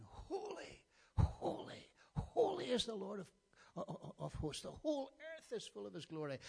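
high, loud ringing cry of praise; angels crying out. Acted. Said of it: "And they're crying".

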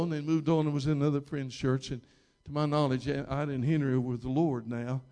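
ordinary delivery

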